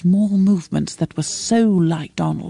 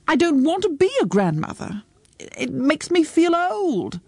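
high-pitched, plaintive protest; acted distress; 9 semitones higher than usual. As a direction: protest as if close to tears. Mother upset about becoming a grandmother.

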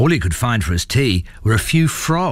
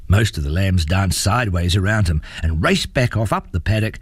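ordinary delivery, nothing marked

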